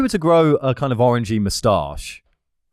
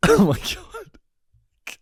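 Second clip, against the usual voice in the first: breathless whispered laughing gasp; helpless laughter; 7 semitones higher, much slower than usual. Real, not acted. Cracking up at the threadworm joke. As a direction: laugh so hard the words barely come out. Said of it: "that's an unbelievable laugh".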